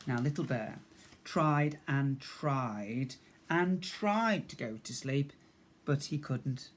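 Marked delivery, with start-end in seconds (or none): none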